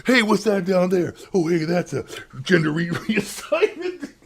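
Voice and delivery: high put-on voice breaking into a laugh; can't hold the bit, cracks up; mock hero laughing. Mock hero spotting something below.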